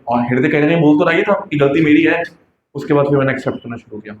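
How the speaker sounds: ordinary talk, nothing marked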